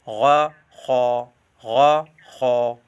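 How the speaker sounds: very slow, heavy गा and खा from the throat; heavy throaty गा खा; thick heavy letters. Shown as a demonstration. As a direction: drag out a thick गा, खा. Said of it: "मोटा करके पढ़ते हैं".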